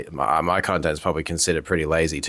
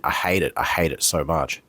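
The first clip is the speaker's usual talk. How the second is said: usual speaking voice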